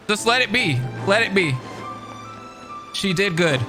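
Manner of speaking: ordinary talk, nothing marked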